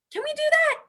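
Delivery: high excited call. Acted. Teacher hypes children to join in, high excited call. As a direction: pump the kids up, high and bright.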